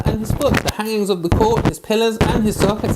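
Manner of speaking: ordinary talk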